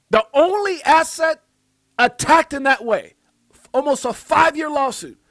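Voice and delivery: high, loud impassioned rant; fired-up tirade against the SEC; heated rant. Real, not acted. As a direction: go after them with outrage. Ranting at the SEC.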